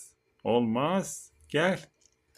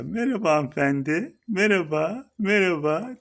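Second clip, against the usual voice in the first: pet greeting; 8 semitones higher, 6 dB louder than usual. Real, not acted. Greeting his pet turtle again.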